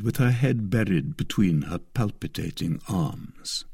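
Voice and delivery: ordinary delivery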